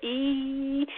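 E drawn out as through a smile; long smiling E. Shown as a demonstration. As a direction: retract the lips and stretch out E. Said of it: "so it's like a smile".

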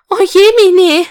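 high, loud cry of dismay. Acted. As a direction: gasp it out, caught off guard. Elisabeth dismayed at discovery. (hoher, lauter Ausruf des Entsetzens)

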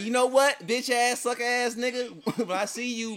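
loud, high-pitched trash talk; hammed-up angry insults; mock online rant. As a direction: play at calling someone out. Acts out an online beef rant.

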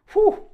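loud high exhale of relief; whew. Real, not acted. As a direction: blow it out, spent from preaching. Exhales after intense preaching.

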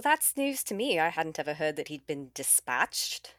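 normal talking voice

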